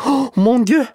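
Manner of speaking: very high, loud French cry of mon Dieu; French exclamation. Acted. Juliette startled by the woodpile, crying out in French. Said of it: "for hun er fransk".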